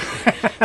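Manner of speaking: short, high burst of laughter; amused laugh. Real, not acted. Dad amused by his son's calm-baby answer, amused laugh. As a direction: laugh briefly, high in pitch.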